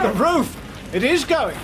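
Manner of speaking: loud, high alarmed cry; alarmed shout. Acted. Inspector warning everyone as the roof collapses, loud alarmed shout.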